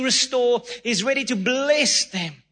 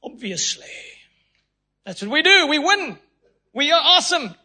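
louder, higher cocky boast; mock smug Americans; 5 semitones higher, 3 dB louder than usual. Americans shrugging off a win, hammed-up smugness. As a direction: brag like it's obvious. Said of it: "The Americans were like".

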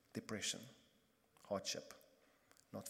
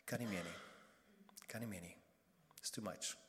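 weary; 4 semitones lower, 7 dB quieter than usual. Retold or imitated. Psalmist giving up on God, soft and worn out. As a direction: sound utterly spent and beaten down.

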